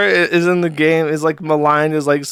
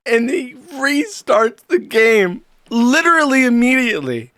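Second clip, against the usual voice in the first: higher-pitched, tickled retelling; amused disbelief; 6 semitones higher than usual. Real, not acted. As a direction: relish the absurd punchline, about to laugh. Amused, breaks into laughter after.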